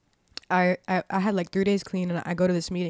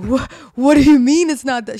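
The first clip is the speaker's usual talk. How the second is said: loud, raised-pitch mock bafflement; retold indignant protest; 6 semitones higher, 15 dB louder than usual. Newcomer defending a fake name to the older lady, indignant. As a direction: replay being caught out, loudly.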